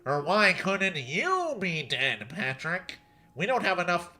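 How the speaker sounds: slow, drawn-out cartoon rant; yelling Squidward. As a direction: snap back in a put-on character voice. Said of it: "yelling at him".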